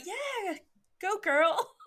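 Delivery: high-pitched karaoke crowd cheer; acted cheering. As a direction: whoop encouragement at a shy singer. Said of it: "and everyone's like".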